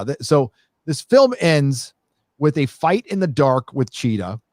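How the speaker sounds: ordinary delivery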